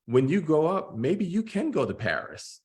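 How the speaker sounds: a teacher handing a kid a book, mock excited encouragement. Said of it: "give a kid a book and say".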